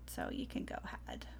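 trailing off into a whisper; a soft whispered aside. Real, not acted. Hands off the reading.

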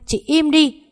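high, loud cut-off; angry outburst. Acted. Trúc silencing mợ hai's accusations, angry outburst. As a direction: silence her with a sharp high shout.

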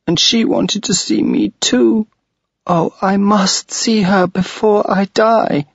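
put-on high, pleading little girl near tears; a lost child begging to see her mother; tearful girl. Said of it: "Then she began to cry".

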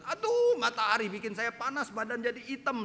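high-pitched whining grumble, acted out; hammed-up protest to God; mock angry complaint. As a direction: wail a drawn "aduh", then gripe. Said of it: "kita marah kepada Tuhan".

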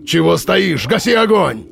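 rising barked command; barked order. A narrator's reading. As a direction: snap out the orders. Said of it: "снова рявкнул".

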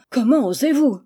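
offended outburst; indignant reply. A narrator's reading. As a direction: draw yourself up and demand it. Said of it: "en se redressant de toute sa hauteur".

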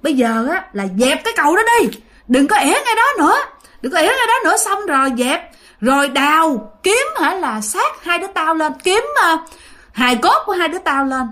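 high, insistent orders; demanding spirit. Acted. Spirit telling grandma what must be done, demanding. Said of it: "Thì ổng mới nói rằng".

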